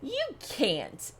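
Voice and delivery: ordinary delivery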